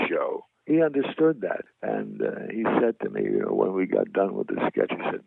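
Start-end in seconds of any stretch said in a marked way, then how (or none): none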